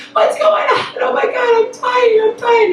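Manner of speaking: high-pitched panicked wailing; retold screaming. Frightened daughter to her mother, high mock screaming. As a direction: imitate a girl sure she's dying. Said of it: "like, screaming".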